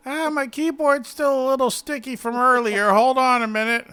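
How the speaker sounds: a hint of the old man.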